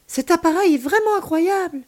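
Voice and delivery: high, loud burst of wonder; amazed. A narrator's reading. Child watching grandmother on alien's screen, amazed. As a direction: marvel at it like a thrilled child.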